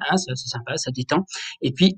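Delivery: ordinary talk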